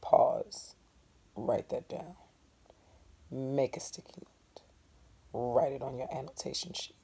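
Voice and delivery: soft, unvoiced instructions; teacher's hushed note to students; whispered aside. Real, not acted. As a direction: murmur it to the class. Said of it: "teacher whispering instructions".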